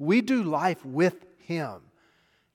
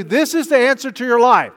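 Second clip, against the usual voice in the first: higher and louder, overselling the answer; mock TV preacher; 7 semitones higher, 9 dB louder than usual. Preacher on TV sells the answer to life, hammed-up and loud. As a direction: ham up a TV preacher's big promise. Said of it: "and they'll tell you".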